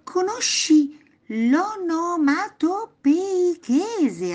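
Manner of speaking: slow, high, drawn-out question; amused exclamation. A narrator's reading. Grandpa teasing the boy, slow amused high question. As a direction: stretch out the funny long word, syllable by syllable. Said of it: "esclamò il nonno ridendo".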